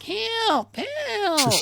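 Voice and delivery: very high, drawn-out whine; hammed-up whiny cry for help; Erk, whiny voice. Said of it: "it's more of a whiny voice".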